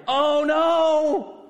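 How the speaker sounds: high, loud anguished cry of "Oh no". Acted. Father seeing his daughter, anguished high cry. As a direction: cry out like a father who just saw his doom. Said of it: "He cried".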